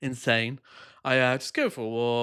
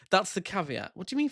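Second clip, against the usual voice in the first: normal talking voice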